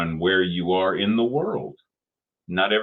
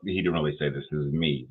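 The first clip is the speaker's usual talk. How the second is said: ordinary talk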